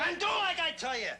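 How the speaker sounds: very high, insistent yelled order; angry yell. Acted. Wade ordering his girlfriend to obey, angry yell. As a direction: yell it to end the argument. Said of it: "Don't yell".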